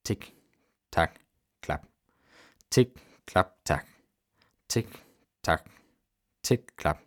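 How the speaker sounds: short soft ticks and claps, spaced apart; quiet, detached clock noises; imitated clock ticking. A narrator's reading. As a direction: tick like a pocket watch, each word isolated. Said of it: "Das Klappern der Uhr vermischte sich mit dem Ticken".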